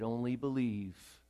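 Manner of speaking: soft, slow, hushed comfort; gentle reassurance. Acted. God comforting someone in the room, soft and slow. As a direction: ease it out softly, almost to one person. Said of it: "Maybe God wants to say to somebody".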